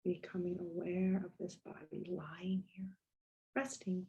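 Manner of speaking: unhurried body-scan guidance with long pauses; guided meditation. Real, not acted. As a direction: gently lead them into stillness, letting phrases settle.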